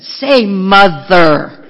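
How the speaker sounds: loud, slow drawn-out order; insisting loudly on the word mother; loud slow demand. Retold or imitated. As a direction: bark it out slowly and loudly. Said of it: "Donna said".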